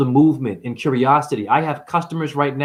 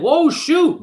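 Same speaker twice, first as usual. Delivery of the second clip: much higher startled cry; mock shock; 13 semitones higher than usual. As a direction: yelp it like a sudden betrayal. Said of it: "The next minute".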